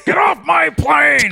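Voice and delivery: slow, high shouted movie line; action hero shout. Pretend. Action-movie quote with a laugh.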